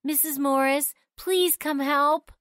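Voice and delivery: put-on girl calling a mother upstairs for help; panicked call. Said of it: "called down to Susan's mom".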